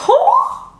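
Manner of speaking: very high, loud "oh" squeal; excited squeal. Real, not acted. Maker reacting while fitting the bow, excited squeal. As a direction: let out a high-pitched squeal.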